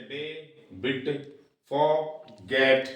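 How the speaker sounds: "डे, बिट" broken in two, "forget" drawn out slowly; split into syllables. Shown as a demonstration. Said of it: "सब में दो दो syllable हैं".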